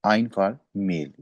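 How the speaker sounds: आइन said slowly and short; slow clear आइन for male; pronunciation demo. Shown as a demonstration. Teacher to class, slow model word. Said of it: "मेल के लिए हम आइन use करते हैं".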